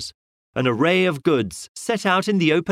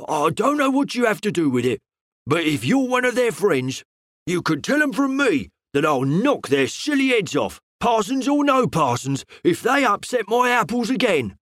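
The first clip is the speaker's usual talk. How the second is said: mild angry fruiterer, higher; a touch of a flustered, cross shopman; angry stammer; 7 semitones higher than usual. A narrator's reading. Fruiterer blustering at the detective, mildly angry and higher. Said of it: "he stammered angrily".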